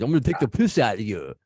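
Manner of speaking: American doing a light fake British accent; mock British accent. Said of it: "if I said that, I would look like a douchebag".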